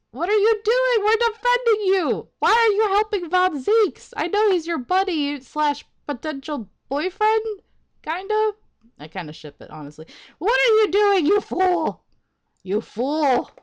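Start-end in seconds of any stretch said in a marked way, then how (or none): 0.1-8.5 much higher, louder shout; frustrated yelling. Real, not acted. Player yelling at his client, much higher and louder. 10.4-13.5 much higher, louder shout; frustrated yelling. Real, not acted. Player yelling at his client, much higher and louder.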